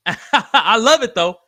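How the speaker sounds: high, loud laughing "I love it". Real, not acted. Host laughing with a chatter who calls himself normal. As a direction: laugh it out with delight.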